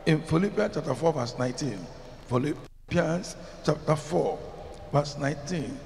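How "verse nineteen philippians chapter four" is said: usual speaking voice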